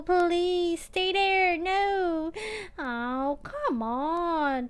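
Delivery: slow, drawn-out begging that breaks into a wail; mock anguished pleading. Real, not acted. Pleading with the spin wheel to stop, slow mock wail. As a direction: beg the wheel to stop, then cry out no.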